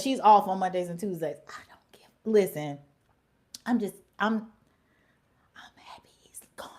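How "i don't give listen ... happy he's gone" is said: secretive whisper of relief. Real, not acted. Admitting to viewers she's glad he's gone, whispered. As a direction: breathe it out under your breath. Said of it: "whispering her relief".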